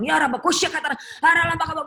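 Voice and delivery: high, loud burst of tongues; forceful, re-enacted spiritual-warfare chant; warlike tongues prayer. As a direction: pray in tongues as if doing battle. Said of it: "saya udah perang gitu".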